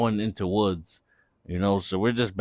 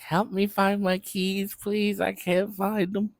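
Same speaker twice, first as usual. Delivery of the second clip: put-on drunk girl pleading; 9 semitones higher, 4 dB quieter than usual. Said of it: "So she's like".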